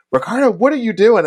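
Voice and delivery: high, fast, exasperated; mildly mock annoyed instructor; Adam's exasperated call. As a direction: snap at him mid-rehearsal. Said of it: "and Adam's like".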